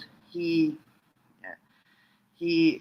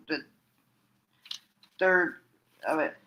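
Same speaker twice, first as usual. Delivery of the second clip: usual speaking voice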